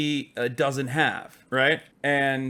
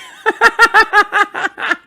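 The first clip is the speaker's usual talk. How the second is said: high, loud burst of laughter; helpless rapid cackle; real laugh; 11 dB louder than usual. Real, not acted. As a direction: crack up at your own joke. Cracks up at own joke.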